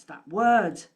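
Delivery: said out loud and punched; loud emphatic. Shown as a demonstration. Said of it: "Oh, that word".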